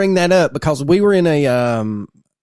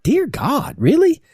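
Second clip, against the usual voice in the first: mock shock; 11 semitones higher, slower than usual. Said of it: "you're like".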